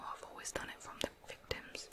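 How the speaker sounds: normal talking voice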